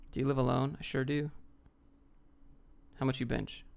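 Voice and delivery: ordinary delivery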